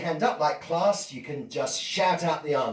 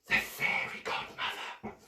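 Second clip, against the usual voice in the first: whisper; 7 dB quieter than usual. Acted. Dramatic reveal to children.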